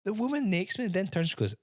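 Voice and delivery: normal talking voice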